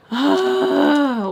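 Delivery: long, high exasperated sigh. Acted. Friend not answering her calls, exasperated sigh. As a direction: let out a big frustrated sigh.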